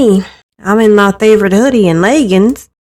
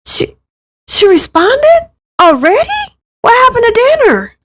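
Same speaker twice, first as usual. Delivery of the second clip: much higher, disbelieving squeak; can't believe she answered so fast; stunned surprise; 13 semitones higher than usual. Acted. Surprised by quick reply.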